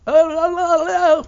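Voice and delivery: very high, loud sing-song "Oh, la, la, la"; yelled teasing call. Retold or imitated. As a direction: call it out across the water to tease. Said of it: "she yelled over".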